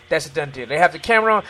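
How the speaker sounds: ordinary talk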